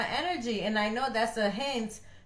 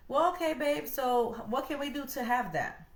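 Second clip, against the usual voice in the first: upbeat encouraging; 5 semitones higher than usual. Retold or imitated. Answering spouse upbeat.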